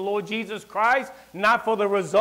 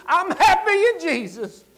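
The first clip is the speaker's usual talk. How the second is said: joyful; 11 semitones higher, 4 dB louder than usual. Acted. Joyful declaration.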